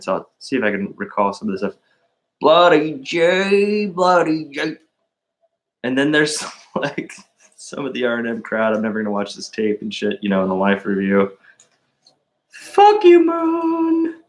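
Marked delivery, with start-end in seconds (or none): 2.4-4.8 high, slow Southern drawl on the name; Southern accent. Retold or imitated. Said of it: "Sweet Tea's Southern accent". 12.5-14.2 mock yell. Mock jab at the R and M crowd.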